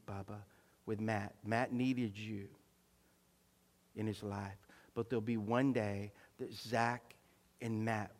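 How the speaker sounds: low, soft, measured retold prophecy; solemn voice of God. Pastor retells what God spoke to him, solemn and hushed.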